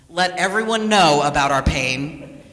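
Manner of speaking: normal talking voice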